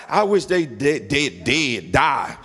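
low, slowing mock curse, stumbling on the words; retold grudge, dropped low in pitch; imitated anger. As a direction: act out a mad person's muttered ill wish, pitched well down. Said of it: "somebody made you really mad".

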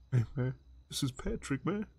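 peanut-voice whisper. Shown as a demonstration. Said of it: "whisper in my peanut voice".

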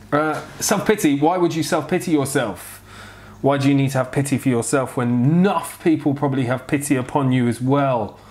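normal talking voice

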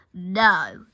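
loud, acted groan of refusal. A narrator's reading. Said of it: "he groaned".

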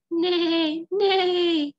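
whinny like a toy horse; horse neigh. Acted.

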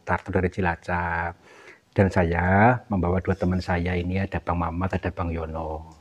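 low, settled, softening voice; gentle reassurance. Real, not acted. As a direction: introduce yourself soothingly to a frightened stranger. Soothing introduction to a crying spirit.

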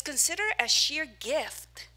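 each word weighed slowly; slow emphatic quote. Shown as a demonstration. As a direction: read the verse slowly and deliberately. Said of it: "what James says".

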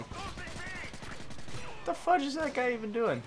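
very high, slow, drawn-out mock whine; mock squeaky voice. Gamer mocking a clueless enemy player, squeaky drawn-out voice. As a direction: stretch it out in a silly squeaky voice.

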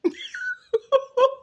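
sudden loud high-pitched laugh. Real, not acted. Cat walks into the video, sudden loud laugh.

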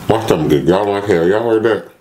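speaking while chewing pizza; words around a half-swallowed bite; talking with food in mouth. Real, not acted. Seen 2: Bites slice at -1s, then talks holding pizza, likely still chewing.

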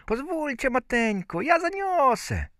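put-on very high-pitched tiny boy; Bździelik. A narrator's reading. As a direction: squeak it like a thumb-sized child asking mother. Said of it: "Bździelik rzekł". (wysoki głosik maleńkiego chłopczyka)

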